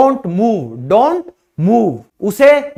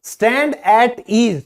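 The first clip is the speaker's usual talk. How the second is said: slow demo; much slower than usual. Shown as a demonstration. Teacher showing students the phrase, very slow. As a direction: stand at ease spelled out very slowly. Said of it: "ध्यान से देखो".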